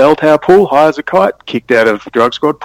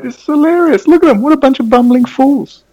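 much higher, mocking delight at fools; amused scorn. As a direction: sneer in amused disbelief. Laughing at police to mate.

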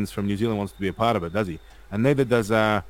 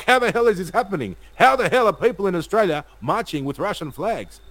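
higher, outraged mock disbelief; mocking Ukrainian government; 8 semitones higher than usual. Streamer mocks Ukrainian government reacting to Australian rallies, high outraged disbelief. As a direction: squeal it like panicked officials caught off guard. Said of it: "They're like".

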